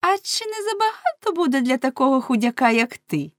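жіночка-співрозмовниця. Acted. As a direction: pitch it well up for the woman's teasing question. (високий жіночий голос)

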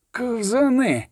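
high-pitched, understated exasperation; grating anger. A narrator's reading. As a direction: grind out the word in frustration. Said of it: "проскриготів, стискаючи кулаки".

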